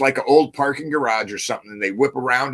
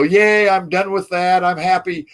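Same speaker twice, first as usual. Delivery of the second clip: mock happy relief; 4 semitones higher, 3 dB louder than usual. Trainee home in own bed, mock happy cheer. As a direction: lightly act out a contented yay. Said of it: "I'm all like, you know".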